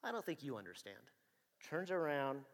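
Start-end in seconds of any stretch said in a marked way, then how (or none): 0.0-1.1 soft, deadpan echo of the warning; quiet flat echo. Retold or imitated. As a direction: throw his words back at him quietly. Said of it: "And he says".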